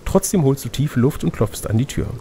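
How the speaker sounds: usual speaking voice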